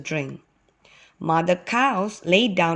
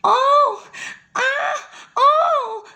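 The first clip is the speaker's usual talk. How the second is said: loud high-pitched wails; acted little-girl shrieks of pain; crying in pain; 5 dB louder, much slower than usual. A narrator's reading. Little girl bitten by a snake, loud crying. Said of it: "cried a little girl".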